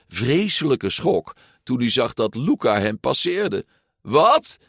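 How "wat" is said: high, loud outraged cry; shrieked. A narrator's reading. Shocked rider is overtaken, a high loud shriek. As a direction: yelp in disbelief, pitched way up. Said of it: "gilde Ercole".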